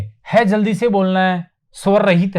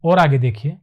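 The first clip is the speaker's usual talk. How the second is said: normal talking voice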